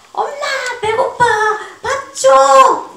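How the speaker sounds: put-on 엄마, 배고파, 밥 줘 in a high, loud, drawn-out child's wail; hungry child calling. Pastor voicing his hungry daughter to the congregation, high loud child's wail. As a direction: call for food like a hungry little girl. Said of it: "우리 성은이가 건강하면요".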